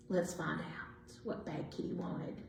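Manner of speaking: a hushed storyteller's aside; hushed teaser. A narrator's reading. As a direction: lean in and tell them softly. Teasing aside to listeners.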